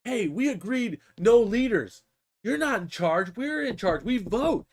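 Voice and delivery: imitated squabbling grots; protesting grots. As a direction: object like an indignant little mob. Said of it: "they're like".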